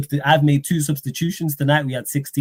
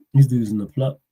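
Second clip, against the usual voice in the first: ordinary talk, nothing marked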